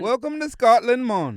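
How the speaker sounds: comically misplaced Jamaican accent. Shown as a demonstration. Failed accent artist greeting Scotland, bad Jamaican accent. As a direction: attempt Scottish and come out Jamaican. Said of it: "I just try Jamaican".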